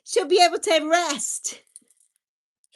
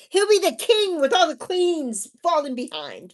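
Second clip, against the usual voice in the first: normal talking voice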